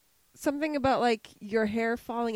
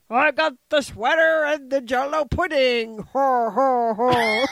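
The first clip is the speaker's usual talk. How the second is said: bad Bill Cosby; 5 dB louder than usual. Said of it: "That was my Bill Cosby".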